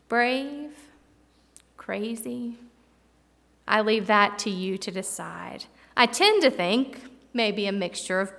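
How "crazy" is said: usual speaking voice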